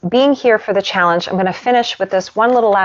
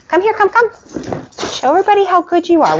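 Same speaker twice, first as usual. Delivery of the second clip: much higher sing-song voice to the dogs; dog talk; 11 semitones higher, faster than usual. Real, not acted. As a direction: coax the pups with a treat. Calling the dogs over.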